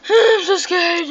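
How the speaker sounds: high, loud put-on whimper; mock scared. Player joking with viewers about fear, mock-scared whimper. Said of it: "I'm just kidding".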